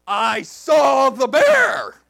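slow, loud, high proclamation; triumphant boast. Retold or imitated. Pastor topping the junior highers' story, triumphant shout. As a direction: shout it as a grand announcement.

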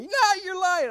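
put-on high, loud kid protest; imitated disbelieving kids. Junior highers accusing pastor of lying, high mock protest. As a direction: throw back a childish accusation.

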